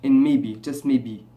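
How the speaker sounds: put-on Nigerian English accent, low-pitched. Shown as a demonstration. Vlogger questioning viewers who kept watching, Nigerian accent. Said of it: "I'll make a Nigerian accent".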